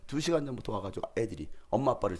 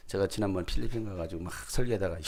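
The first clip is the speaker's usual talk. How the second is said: usual speaking voice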